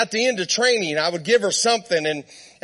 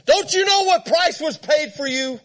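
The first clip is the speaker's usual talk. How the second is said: retold frustrated yell; 6 semitones higher than usual. Preacher to his stubborn horse, mild frustrated yell. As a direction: cry it out in mild mock frustration. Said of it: "I yelled at her".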